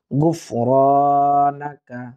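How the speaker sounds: গুফরানাকা said slowly, each syllable drawn out; slow careful drill. Shown as a demonstration. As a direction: draw out গুফরানাকা for the students. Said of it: "এক আলিফ টানবো". (গুফরানাকা ধীরে টেনে উচ্চারণ)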